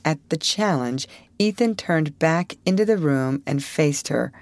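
usual speaking voice

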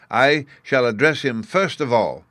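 a hint of a pompous lecturer; explanatory professor. A narrator's reading.

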